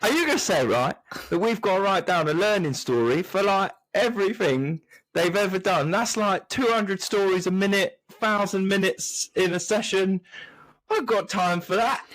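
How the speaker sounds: high, put-on Cockney rant; sarcastic Cockney teacher, pitched high; Cockney accent. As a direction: grumble it like a cheeky Cockney. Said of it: "use that accent before".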